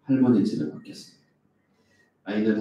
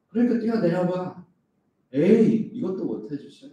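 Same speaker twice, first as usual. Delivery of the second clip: higher, sneering taunt; a hint of Satan's mocking dare; mocking tempter; 9 semitones higher than usual. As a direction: goad God with a scoff. Preacher voicing Satan's taunt.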